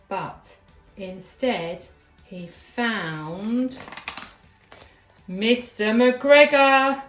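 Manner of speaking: loud, drawn-out storyteller's announcement; big, stretched-out reveal for young listeners; dramatic reveal. A narrator's reading. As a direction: announce the villain slowly and loudly.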